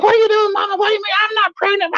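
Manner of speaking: very high, frantic denial; panicked girlfriend. Retold or imitated. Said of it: "she's like".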